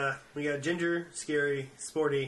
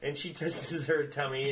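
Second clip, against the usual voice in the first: normal talking voice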